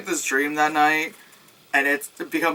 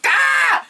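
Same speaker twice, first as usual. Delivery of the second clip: sudden high yelp of fright; a jump-scare shriek, high and loud; startled scream; 5 dB louder than usual. Retold or imitated. Retelling the theater jump scare, a sudden high scream.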